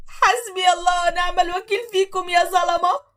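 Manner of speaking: high, slow wailing curse; slow crying curse. Acted. Wife wailing her curse at the oppressors. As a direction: wail it at them.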